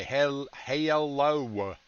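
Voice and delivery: HELLO stretched into slow Southern "hay-uh-lo-wuh"; Southern drawl, extra syllables. Shown as a demonstration. Streamer demonstrating wife's Southern way of speaking, exaggerated South Carolina drawl. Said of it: "they add syllables to a word".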